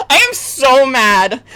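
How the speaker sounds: slow, loud furious "mad"; mad shout. Real, not acted. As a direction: yell "I am so mad" drawn out. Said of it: "I've never been this mad on stream".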